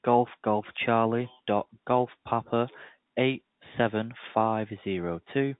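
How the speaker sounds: slow, careful reading back; slow readback. Real, not acted. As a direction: read it back slowly. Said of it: "So just to clarify".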